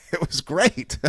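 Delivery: said through a laugh; chuckling as the words come out. Real, not acted. To the listeners, about Harley moving out, laughing.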